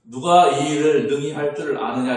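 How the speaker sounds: a preacher's loud challenge to the room; loud preaching question. Acted. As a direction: throw the question out to the congregation. Rephrased question, much louder.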